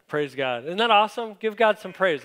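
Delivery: rousing praise call. Real, not acted. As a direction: lift the room into praise. Pastor rallies congregation to praise.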